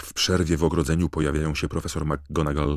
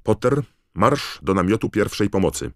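higher, louder teacher's command; brisk order; 6 semitones higher, 5 dB louder than usual. A narrator's reading. Teacher sending Harry to the first aid tent, commanding. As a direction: order the pupil off firmly.

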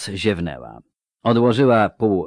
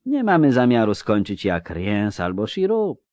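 ordinary delivery, nothing marked

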